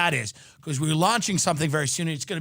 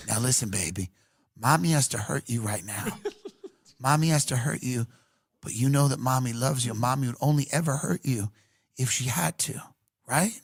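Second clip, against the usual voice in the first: creepy mother; 6 semitones lower than usual. Acted. Mother soothing child before burning.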